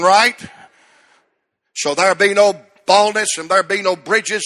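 loud, rising sermon climax; full-force preaching. Acted. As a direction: preach it to the congregation, building.

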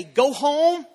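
rally the church to go home; food drive call. Retold or imitated. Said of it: "people say".

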